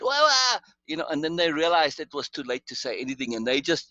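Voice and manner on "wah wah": high, loud mock wailing; mock panic wail. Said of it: "these guys just about died".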